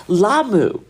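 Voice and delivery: imitated correction, "Lamu" said as "LAH-moo"; friend's corrected Lamu. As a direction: stretch the first syllable of "Lamu" into "LAH-moo". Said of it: "making fun of my American accent".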